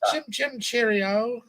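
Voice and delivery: high movie-Cockney lilt; mock Cockney. Friend parodying Cockney as heard in movies, mock Cockney. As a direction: chirp it like a cartoon Cockney. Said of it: "Cockney type shit".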